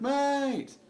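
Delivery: high, loud yell of mate; shouting across the train carriage; high yelled call; mock yell. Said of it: "started yelling".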